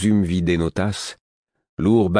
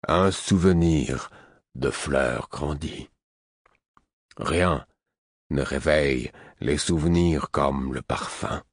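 put-on slow old hermit; 2 semitones lower, slower than usual. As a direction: savour each word slowly. Host speaks slowly.

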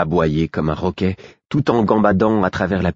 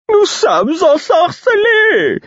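very high, loud, drawn-out singsong; gleeful jester proclaiming it in falsetto; amiable fool; 6 dB louder, much slower than usual. Acted. Fool announcing the bewitchment, amiable singsong. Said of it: "d'un ton aimable".

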